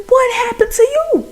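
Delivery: very high, acted-out astonishment; mock shocked question. Laura seeing Michael's face, shocked high voice. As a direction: imitate a startled woman, pitch way up. Retold wife sees battered face.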